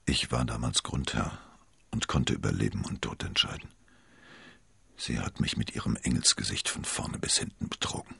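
low, hushed confession; barely audible. A narrator's reading. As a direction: speak low and heavily, almost to yourself. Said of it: "Athos sprach kaum hörbar weiter". (kaum hörbar, mit tiefer Stimme)

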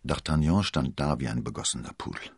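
ordinary delivery, nothing marked

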